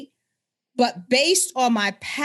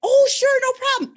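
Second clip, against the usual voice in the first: much higher, loud, sing-song eager falsetto; overly eager helper; 5 dB louder than usual. Retold or imitated. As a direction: gush a cheery yes like a too-eager race volunteer. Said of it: "was like".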